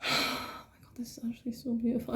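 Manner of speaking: breathy whisper of wonder; whispered awe. Real, not acted. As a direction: whisper it, overwhelmed.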